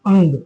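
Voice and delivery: "अंग" modelled with its nasal before the g; demonstrated pronunciation. Shown as a demonstration. Teacher repeating the letter's sound for students, careful demonstration of "अंग". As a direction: say "अंग" as ang, for learners. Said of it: "इसका जो उच्चारण होता है".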